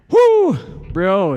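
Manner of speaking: loud cheering holler; hyped shout to the artist; excited whoop. Real, not acted. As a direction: shout your praise out to him.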